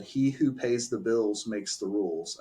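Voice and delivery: matter-of-fact deadpan quote; unimpressed, even-toned retort. Retold or imitated. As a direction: deliver it dry, without any fuss. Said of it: "just deadpan said".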